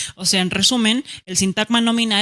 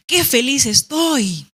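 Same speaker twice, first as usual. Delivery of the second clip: higher, slow, delighted; joyful exclamation; 4 semitones higher, 3 dB louder, slower than usual. Shown as a demonstration. Teacher demonstrating an exclamation to students. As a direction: savor the happiness in it. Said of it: "Por ejemplo".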